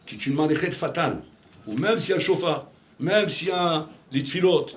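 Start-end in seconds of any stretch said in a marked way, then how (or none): 1.7-4.7 building sermon. Acted. Rabbi urges congregation that nothing will help without return, preaching hard. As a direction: drive it home with each repeated même.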